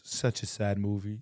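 low, soft mumbled aside; deadpan interjection. Retold or imitated. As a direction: mimic an offhand remark dropped mid-conversation. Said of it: "He goes".

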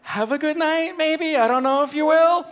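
hammed-up, high-pitched uncertain questioning; "night" pushed high into a doubtful question; exaggerated questioning. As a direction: imitate a wildly unsure well-wisher, pitch way up. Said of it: "He said it like a question".

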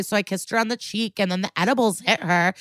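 ordinary talk, nothing marked

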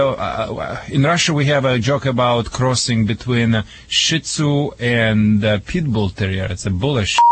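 ordinary talk, nothing marked

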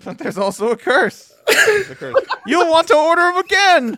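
high, loud proclaiming herald; royal herald. Pretend. Ominous curse warning, royal herald.

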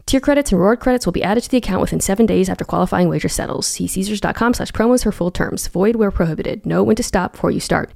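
fast legal disclaimer. Acted.

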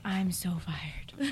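slow, hushed whisper; whispered dread. Retold or imitated. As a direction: whisper it slowly as a doomed realization. Recalling her dread, under the breath.

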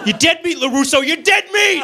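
high, loud taunting yell; bully shout. Acted. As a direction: scream it like a movie bully. Karate Kid bully quote.

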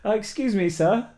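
polite customer service voice; phone voice. Retold or imitated. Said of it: "I went there with my phone voice".

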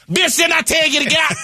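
hammed-up Madea yelling at someone to get out. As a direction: shout like an outraged old auntie. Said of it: "hold my purse".

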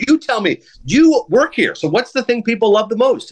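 eager asking. Retold or imitated. Pressing waitress for recommendation.

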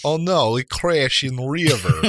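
hammed-up Icelandic crash victim, slow; broken-English Nordic panic, slow and loud; jokey Icelandic accent, drawn out; mock Icelandic accent. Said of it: "I try to do Icelandic".

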